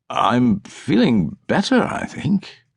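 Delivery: slow, nervous words trailing into a breathy whisper; unsure, hoping to get out of there. A narrator's reading. Hospital patient to doctor, nervous and trailing off. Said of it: "Brunetti said nervously".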